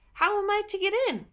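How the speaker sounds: put-on high, fast girlish question; a young Alice asking to get in. A narrator's reading. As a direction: ask eagerly like a young girl. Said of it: "said Alice".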